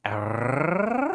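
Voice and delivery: a rolled "Arr" rattled in the throat; rolled R sound. Shown as a demonstration. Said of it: "I do the same R rolling thing".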